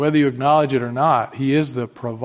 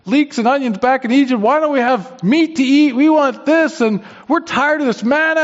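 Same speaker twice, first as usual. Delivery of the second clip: whiny complaining; 10 semitones higher, 4 dB louder than usual. Retold or imitated. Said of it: "They just kept complaining".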